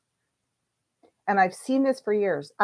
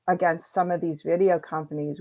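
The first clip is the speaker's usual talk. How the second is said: usual speaking voice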